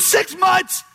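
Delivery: sharp high incredulous echo; shocked disbelief. Retold or imitated. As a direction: throw the words back in disbelief. Sister outraged at delay.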